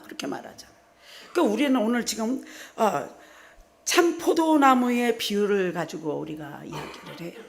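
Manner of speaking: ordinary talk, nothing marked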